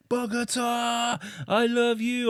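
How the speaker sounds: put-on high, stretched, drunken joyful yell; mock drunk serenade to Bogota; drunken shout out the window. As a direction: sing it out the taxi window to the whole city. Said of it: "I'm so drunk".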